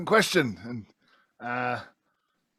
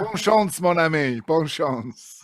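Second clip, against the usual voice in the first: French farewell; 4 semitones higher, 6 dB louder, slower than usual. Acted. Player mocking farewell in French.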